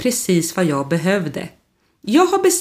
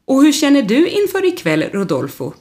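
eager question; 8 semitones higher than usual. Acted. Klaus eagerly asking Rodolfo about tonight, high and bright. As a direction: lift it up high, curious and keen.

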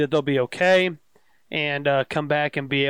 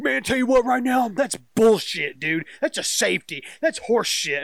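mock outrage, easing off toward the end; retold outraged rant; 6 semitones higher than usual. Retelling a customer griping about the missed safety, mock indignant. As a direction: imitate an indignant fan, pitched up.

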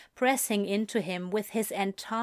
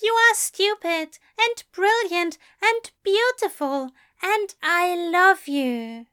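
fond declaration; 9 semitones higher, 5 dB louder than usual. A narrator's reading.

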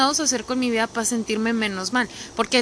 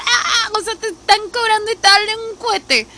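high, loud incredulous retort; mock disbelief; 12 semitones higher, 6 dB louder than usual. Speaker retorting to a lazy friend, loud mocking disbelief. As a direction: scoff at the absurdity, pitched up. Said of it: "Y dices".

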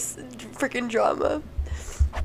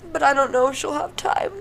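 ordinary talk, nothing marked

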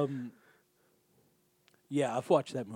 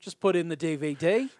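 imitated New Zealand accent, a bit louder; 3 semitones higher, 5 dB louder than usual. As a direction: put on an emphatic mock New Zealand accent. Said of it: "I can do New Zealand accent".

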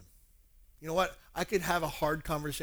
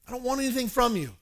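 high, emphatic plea; earnest insistence; 8 semitones higher, 4 dB louder than usual. Retold or imitated. Paul assuring church he wants nothing, insistent. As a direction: insist you seek nothing for yourself.

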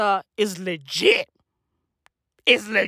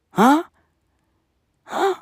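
ordinary delivery

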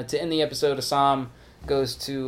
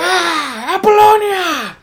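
hammed-up anguished scream; very high, loud mock heart-attack yell; Al Pacino; 13 dB louder, much slower than usual. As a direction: howl the name in overblown agony. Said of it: "screaming shit like that".

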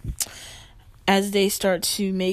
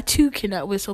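ordinary delivery

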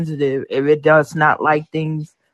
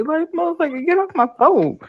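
pitched-up yell; high yelling; 13 semitones higher than usual. Retold or imitated. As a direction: shriek it at the phone.

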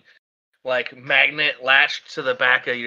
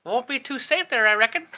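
lightly higher drawling store lady; folksy old lady; 8 semitones higher than usual. Acted. Shopkeeper suggests duct-taping chairs to the trailer, folksy. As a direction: homespun advice, a bit doubtful. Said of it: "She's like".

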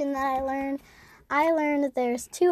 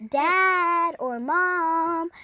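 calling out; 5 semitones higher, much slower than usual. Pretend. Calling parents across a store.

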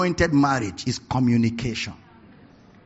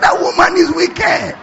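mock shout, higher; 14 semitones higher, 8 dB louder than usual. Preacher repeating the friend's verdict to the crowd, shouting high. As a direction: shout the punchline higher and louder.

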